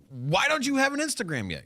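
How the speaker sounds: high-pitched friend voice; high mocking friend. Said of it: "they're like".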